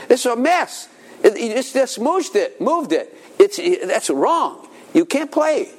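mock parental scolding. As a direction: scold the kid for the mess. Father scolding son's messy room.

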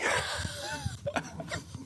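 a soft, very high giggle; soft high laugh. Real, not acted. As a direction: giggle quietly at yourself. Laughs at being rebuked.

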